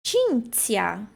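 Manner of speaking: "Cinzia" as CHIN-tsia, clearly separated sounds; Italian ci and zi. Shown as a demonstration. Teacher models a student's name, careful pronunciation. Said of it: "C I e depois o Z I".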